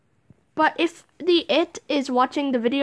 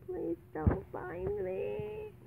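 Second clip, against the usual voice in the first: slow hushed plea, very soft; whispering while hiding; 6 semitones higher, 14 dB quieter, much slower than usual. Real, not acted. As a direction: breathe it out so he can't hear. Hiding, begging seeker.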